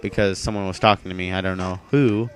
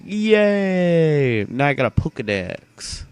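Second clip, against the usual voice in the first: mock yay; 7 semitones higher, slower than usual. Mock cheering at getting Pokedex.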